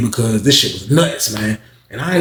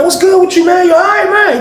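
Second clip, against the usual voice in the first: very high, louder, pushy concern; high fussing; 6 dB louder than usual. Retold or imitated. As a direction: crowd him with high, loud questions. Bro fusses over Paul.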